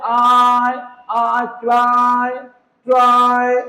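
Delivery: आई, then क्राई and ट्राई with stretched "aaai". Shown as a demonstration. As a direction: draw out the आई in क्राई. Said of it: "तो क्या पढ़ते हैं?".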